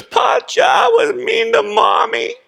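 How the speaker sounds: high, squeaky little-girl voice through a mock sob; tearful little girl. Granddaughter confessing to grandpa about Mommy, high tearful child voice. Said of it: "She goes".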